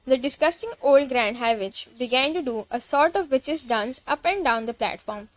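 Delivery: ordinary delivery, nothing marked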